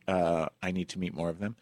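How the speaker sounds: usual speaking voice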